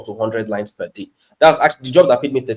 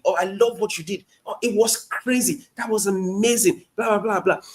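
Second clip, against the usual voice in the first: higher, gushing mock flattery; whining flattery; 8 semitones higher, 4 dB quieter than usual. Shown as a demonstration. Cold email first line to a professor, mock gushing whine. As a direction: gush over their work like a lightly hammed fan. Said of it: "Authentic whining, honest whining".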